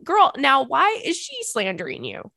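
high, loud and slow, dragging out the indignation; mock outrage. Real, not acted. To the listener, about the lady, slow mock-indignant outburst. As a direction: stretch it out like a scandalized best friend.

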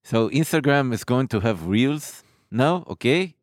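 imitated Israeli accent with drawn-out filler sounds; put-on Israeli accent. Shown as a demonstration. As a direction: do a mock Israeli accent, hesitating on long ehs. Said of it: "the Israeli accent is a lot like this".

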